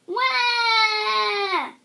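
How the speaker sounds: loud, high mock baby wail. Baby wailing at the girl, a loud fake cry.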